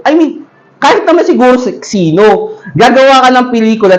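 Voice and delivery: ordinary talk, nothing marked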